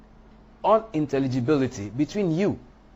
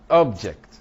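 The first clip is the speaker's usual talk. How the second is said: "object" punched loud on OB; stress on first syllable; 7 dB louder than usual. Shown as a demonstration. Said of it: "is in the placement".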